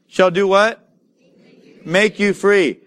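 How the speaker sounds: normal talking voice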